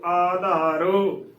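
ਆਧਾਰੋ slowly with long first "aa"; correct long-vowel reading. Shown as a demonstration. Teacher spelling out the word, ਆਧਾਰੋ with long first "aa". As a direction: stretch the opening vowel of ਆਧਾਰੋ. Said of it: "ਐੜੇ ਨੂੰ ਕੰਨਾ ਆ".

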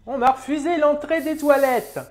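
put-on high, slow, loud old-woman voice; indignant old dear, drawn out; old lady. Old woman complaining about the toilets, old-lady voice. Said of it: "c'est un vieux crouton".

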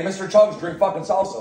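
ordinary talk

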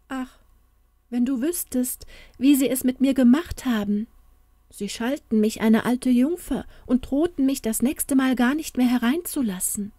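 leicht gespielte gekränkte Tochter, höhere Stimme; klagendes Märchen. Acted. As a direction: der Mutter das Leid klagen. Märchen tearfully complains on. (angedeutet weinerliche, höhere Klage)

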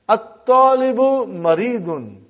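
At-Talibu Maridun, slow with the assimilated t; slow Arabic demo. Shown as a demonstration. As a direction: spell out At-Talibu for the class. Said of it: "The student is sick".

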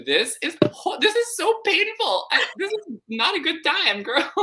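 high, pained mock whine; mock exasperated voice. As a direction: whine it like it hurts to read. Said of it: "And I'm like".

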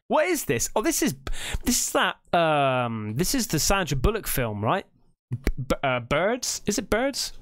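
normal talking voice

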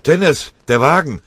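laut und viel höher; schreiend. Acted. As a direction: schrei die Warnung heraus. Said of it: "schrie Linsenmeier". (laute, hohe Warnung)

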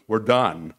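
loud, falling We're done; emphatic loud done; triumphant declaration. Retold or imitated. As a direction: slam We're done down like a verdict. Attorney declares building finished.

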